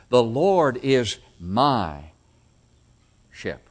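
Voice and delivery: very slow, long "my", then a pause before "shepherd"; personal emphasis. Acted. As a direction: stretch "my" and let it hang. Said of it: "That's personal".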